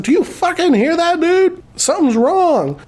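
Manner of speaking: high-pitched urgent imitation; hammed-up panic, voice way up; mock alarm. Imitating Dan waking Jeffrey after the cat noise, mock alarm.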